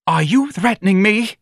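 affronted wife. A narrator's reading.

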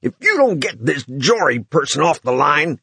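breathless angry snarl; half gasped, half snarled. Acted. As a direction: snarl it out while gasping. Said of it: "he half gasped, half snarled".

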